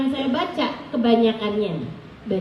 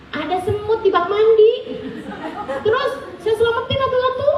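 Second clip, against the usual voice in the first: imitating an earnest husband in a high pitch; mock-earnest explanation; 8 semitones higher than usual. Husband explaining he rescued ants one by one, mock-earnest. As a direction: climb higher with each absurd excuse, slowing at the end.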